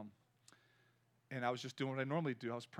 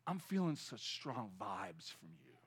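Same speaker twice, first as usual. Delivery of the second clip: a lightly voiced businessman, lower, soft and slow; 6 semitones lower, 6 dB quieter, slower than usual. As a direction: lightly mimic the awed stranger. Businessman quoted in elevator.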